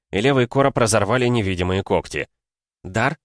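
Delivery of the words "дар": usual speaking voice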